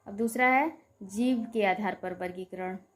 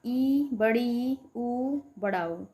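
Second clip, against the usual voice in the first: slow vowel demo; much slower than usual. Shown as a demonstration. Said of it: "मुख द्वार लगभग पूरा बंद सा होता है".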